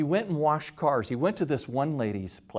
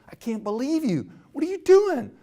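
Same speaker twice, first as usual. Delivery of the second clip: mock-outraged, going much higher and louder at the end; imitating a furious kid; 13 semitones higher, 6 dB louder than usual. A dad imitating his furious twelve-year-old son, much higher and louder. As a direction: pitch climbs to a shrill louder outburst. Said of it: "He was furious".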